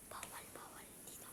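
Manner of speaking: urgent hushed whisper. Real, not acted. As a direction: whisper so the seeker can't hear. Hiding, whispering directions.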